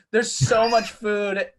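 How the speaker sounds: slow, high, dreamy marvel; dazed coworker. Retold or imitated. Reed in the kitchen, slow dreamy awe. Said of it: "because he's like".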